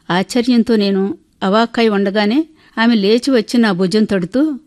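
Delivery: plain reading voice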